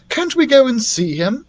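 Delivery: high, eager question; voiced boy Billy. Billy pleading with the doctor, high and eager. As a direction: ask eagerly like a keen boy.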